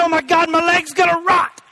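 high, panicked mock wail; hammed-up alarm. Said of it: "I didn't say".

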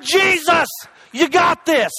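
high, emphatic faith shout; confident preacher's cry. Retold or imitated. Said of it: "I'm gonna cry out to the one".

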